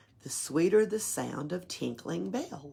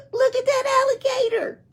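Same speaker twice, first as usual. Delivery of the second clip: much higher, louder, fast mocking voice; tiny ornament man; 9 dB louder, faster than usual. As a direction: tease him like a tiny mischievous ornament. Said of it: "one of 'em said".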